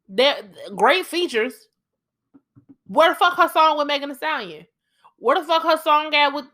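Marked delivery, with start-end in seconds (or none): none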